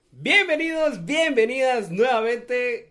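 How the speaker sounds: high, slow announcer welcome; show host intro. Shown as a demonstration. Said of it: "Te salió bien, ahí está".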